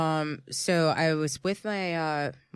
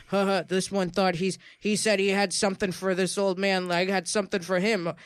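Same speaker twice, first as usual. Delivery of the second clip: mocking cops; 4 dB louder than usual.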